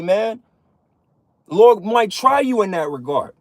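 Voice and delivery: ordinary talk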